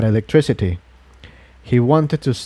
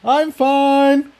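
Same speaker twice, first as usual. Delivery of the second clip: shout from above; 14 semitones higher than usual. Acted. Jigsaw shouts from the next level up, reassuring. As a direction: shout down from upstairs. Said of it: "Jigsaw shouted from the next level up".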